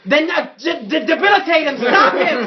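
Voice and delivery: high, loud, stumbling commands; urgent in-character yelling; frantic orders. Acted. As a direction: scramble for words while shouting orders. Player frantically shouts orders to henchman.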